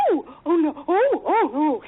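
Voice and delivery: high, wailing oh no's; fretting auntie. Retold or imitated. Aunt dithering over the quarrel, high fretful wails. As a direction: flap like a panicked aunt. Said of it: "my auntie would just go".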